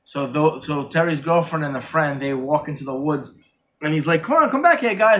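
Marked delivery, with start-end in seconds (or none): 4.2-5.2 high-pitched mock call after friends; lightly acted plea to return. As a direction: imitate calling people back. Calling friends back, acted quote.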